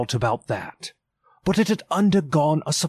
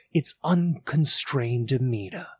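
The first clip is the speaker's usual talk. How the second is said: ordinary delivery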